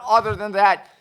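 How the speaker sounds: usual speaking voice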